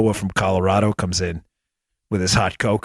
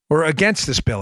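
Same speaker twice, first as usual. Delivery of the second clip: usual speaking voice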